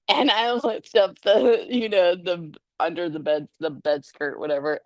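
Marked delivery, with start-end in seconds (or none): none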